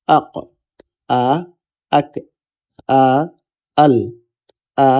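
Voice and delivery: each syllable like আব said short, closed and isolated; sukun drill. Shown as a demonstration. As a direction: chant আ, then আব, cut off on the consonant, with pauses. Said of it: "এভাবে উচ্চারণগুলো হবে". (আ, আব: সুকুন মিলিয়ে আলাদা আলাদা উচ্চারণ)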